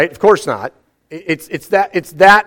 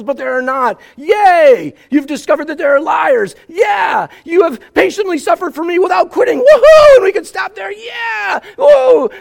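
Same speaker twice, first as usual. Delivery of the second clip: mock cheering; 7 semitones higher than usual. Preacher cheering as the church hearing God's praise, excited whoops. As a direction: whoop along like a delighted crowd.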